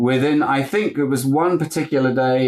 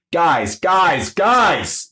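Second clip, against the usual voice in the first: exasperated scolding; 6 semitones higher, much slower than usual. Retold or imitated. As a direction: hush rowdy actors, exasperated. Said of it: "he never once went".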